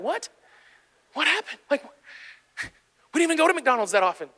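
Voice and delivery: high-pitched baffled husband; comic, incredulous retold reaction; acted bewilderment. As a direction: question it in mock disbelief.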